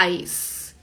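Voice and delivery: ice, soft S; ice with S sound. Shown as a demonstration. Teacher modeling ice with a soft S. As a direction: say ice with a hissed S. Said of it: "la vas a pronunciar con sonido de S".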